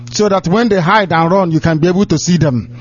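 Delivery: ordinary delivery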